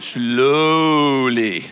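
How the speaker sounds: "slowly" stretched long on its first vowel; drawn-out slowly. Shown as a demonstration. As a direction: stretch out "slowly" to show slowness. Draws out the word to illustrate.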